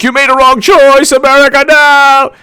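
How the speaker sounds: high, loud mock wail; hammed-up high-pitched meltdown; James Comey. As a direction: yell it way up high in protest. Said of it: "suddenly James Comey is like".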